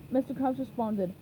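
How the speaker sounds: usual speaking voice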